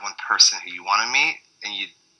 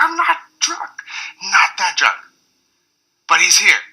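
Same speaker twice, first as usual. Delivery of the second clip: much higher, excited insistence; insist, still squealing with excitement; giddy protest; 11 semitones higher than usual. Retold or imitated. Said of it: "I was like".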